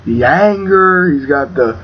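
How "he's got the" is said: usual speaking voice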